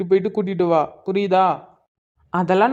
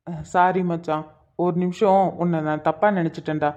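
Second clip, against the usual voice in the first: ordinary delivery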